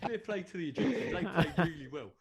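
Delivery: high, loud, laughing through it; laughing mock pundit. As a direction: fake praise while cracking up.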